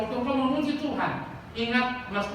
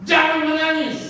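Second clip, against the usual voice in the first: loud, high command to the congregation; emphatic preaching shout; 7 semitones higher, 9 dB louder than usual. Real, not acted. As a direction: shout it as a firm order. Preacher calls out the answer. (seruan lantang dan tegas)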